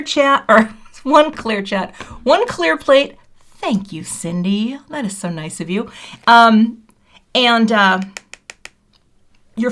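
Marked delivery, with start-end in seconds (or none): none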